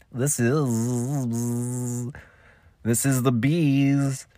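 drawn-out buzzing on "is" and "bees"; buzzing announcer. Shown as a demonstration. Podcaster goofing with his ident, buzzy drawn-out delivery. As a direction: stretch the z of "is" and "bees" high and long.

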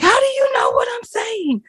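high, startled challenge; shocked demand. Retold or imitated. Strangers catch her understanding them, startled high demand. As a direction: blurt it like you're caught off guard. People confronting the speaker.

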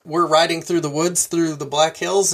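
normal talking voice

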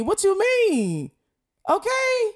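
high, slow sassy squeal; hammed-up high sassy voice; sassy mock retort.

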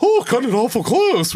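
high mock Home Alone line; hammed-up film line; movie quote voice.

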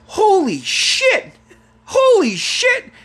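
high, stretched-out cries of shock; stunned exclamation. Retold or imitated. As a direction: draw out each shocked word up high. Shocked reaction, repeated.

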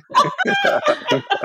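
a sudden squealing laugh; high burst of laughter. Real, not acted. Laughing at the brother's joke.

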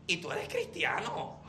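pregunta baja y grave, con duda sobre CRISTIANO; pregunta con recelo. Shown as a demonstration. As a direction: pregunta «¿Y tú eres cristiano?» como dudándolo, en voz baja. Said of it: "connotación completamente diferente". (duda insinuada en «cristiano»)

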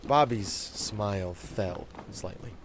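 usual speaking voice

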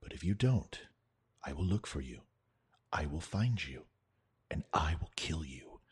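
low, hushed, deadly calm threat; quiet menace as if over the phone; menacing movie threat. Acted. Threatening movie-villain phone speech.

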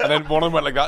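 usual speaking voice

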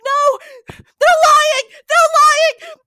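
very high, very loud terrified screaming; hysterical shrieking. Acted. Catherine shrieking in terror at the others, panicked screams. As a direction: shriek in panic, begging them all. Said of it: "starts shrieking".

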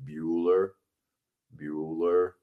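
low, soft, droning roll call; deadpan monotone teacher calling roll; Ben Stein Bueller. Retold or imitated. Teacher calling roll in Ferris Bueller, low droning monotone. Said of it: "the teacher that was in summer school saying".

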